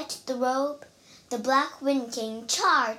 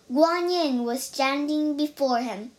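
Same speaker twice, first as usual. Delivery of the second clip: usual speaking voice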